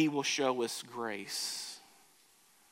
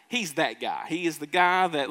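ordinary talk

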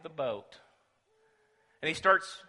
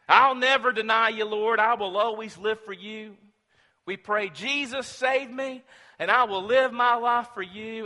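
mock vow; 8 semitones higher, 11 dB louder than usual. Voicing believers' loud vows.

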